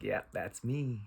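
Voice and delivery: soft, pleased-with-himself aside; proud cat. Acted. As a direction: murmur it as the self-satisfied cat. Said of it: "pointing to himself like".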